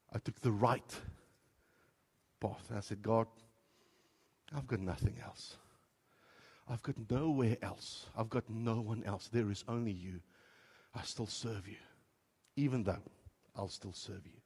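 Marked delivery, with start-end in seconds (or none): 3.0-14.4 much softer, broken whisper of faith; hushed, fading vow; tearful surrender prayer. Retold or imitated. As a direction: pray it quietly through tears. Through tears.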